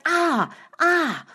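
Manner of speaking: high, sudden cry of alarm; startled cry. Acted. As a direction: jolt awake crying out high. Princess wakes again crying out.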